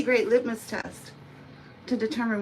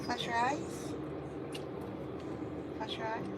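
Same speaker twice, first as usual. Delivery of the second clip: quiet gentle coax; soft coaxing; 10 dB quieter than usual. Real, not acted. Groomer softly coaxing the cat during the blow-dry. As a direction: murmur it to soothe her.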